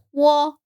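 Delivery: 我 at normal speaking pitch. Shown as a demonstration. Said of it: "这是normal pitch".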